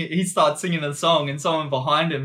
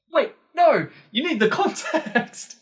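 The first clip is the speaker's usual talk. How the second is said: high, excited voice cutting in; mock eager explainer; 12 semitones higher, slower than usual. Mimicking a friend who insists on more context, high and eager. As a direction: playfully impersonate the friend insisting.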